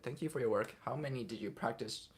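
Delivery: ordinary talk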